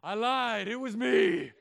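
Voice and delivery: put-on imagined kid proudly owning up; proud mock confession. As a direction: blurt it out proudly. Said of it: "never had a kid be like".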